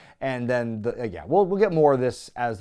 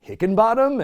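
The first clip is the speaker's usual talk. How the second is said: high, louder puzzled Hickenbottom; mock surprise; 8 semitones higher, 4 dB louder than usual. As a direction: ask it in disbelief. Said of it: "Triple H is like".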